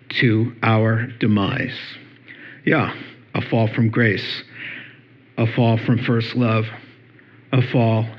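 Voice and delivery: earnest pulpit warning about spiritual danger; solemn preaching. Acted.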